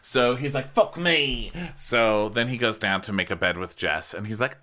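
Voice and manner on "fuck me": higher exasperated quote; mock exasperation. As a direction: imitate his put-upon groaning complaint. Mimicking his exasperated reaction.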